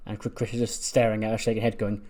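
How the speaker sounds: ordinary talk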